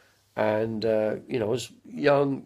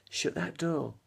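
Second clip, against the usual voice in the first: softer, drawn camp line; softer campy delivery of Shut that door; Larry Grayson catchphrase; 4 semitones higher, 7 dB quieter, slower than usual. Pretend. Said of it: "that was Larry Grayson".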